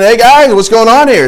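high, loud mock call-out; playful trash talk. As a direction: taunt them like a competitive rival. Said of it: "a little bit of that competitive trash talk".

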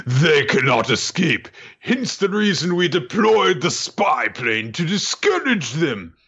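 high, slow villain; slow and scheming. Retold or imitated. Villain Zeno voiced.

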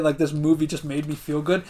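ordinary talk, nothing marked

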